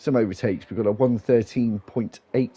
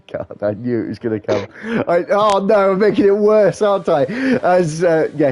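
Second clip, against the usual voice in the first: much higher and louder, laughing; 8 semitones higher, 8 dB louder than usual. Real, not acted. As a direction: laugh through the words, embarrassed. Laughing at own mispronunciation.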